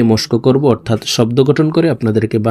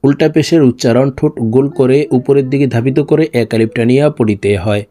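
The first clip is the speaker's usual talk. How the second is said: normal talking voice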